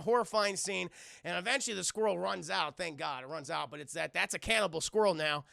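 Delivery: ordinary delivery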